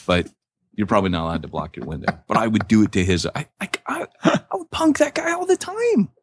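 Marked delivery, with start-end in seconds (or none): 3.4-6.1 squealing high through laughter; real high-pitched cracking up; laughing while talking. Real, not acted. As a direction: barely get it out, voice pitched way up with giggles. Cracking up over his prank idea.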